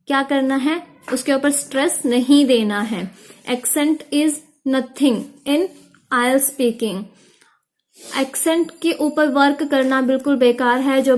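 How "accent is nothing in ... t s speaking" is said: very slow, stretched words; slow key point. Shown as a demonstration. Teacher to students, slowly stressing a rule. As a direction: drive the point home slowly.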